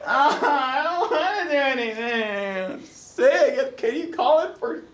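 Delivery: high, drawn-out mock sickly wail; baby mode whine. Sick man begging significant other, mock babyish whine. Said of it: "went into that baby mode".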